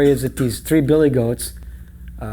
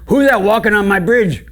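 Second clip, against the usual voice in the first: loud, raised troll challenge; roaring troll; 8 semitones higher, 7 dB louder than usual. Acted. Troll challenging medium goat on his bridge, loud holler.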